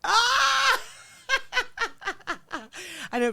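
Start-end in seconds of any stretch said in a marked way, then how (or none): 0.0-0.8 loud, high-pitched scream; sudden shriek before laughing. Real, not acted. Reacting to advice on paying someone, high scream.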